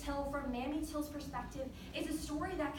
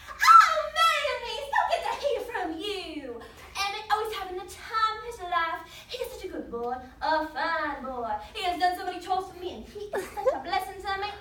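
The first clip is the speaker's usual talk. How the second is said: loud, high, gushing phone voice; effusive delight; 5 semitones higher, 8 dB louder than usual. Acted. Relative praising Emmett to his mother by phone, gushing.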